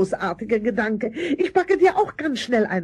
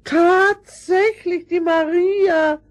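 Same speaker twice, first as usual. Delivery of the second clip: surprised; 7 semitones higher, 4 dB louder, much slower than usual. Acted. Aunt recognises golden Maria.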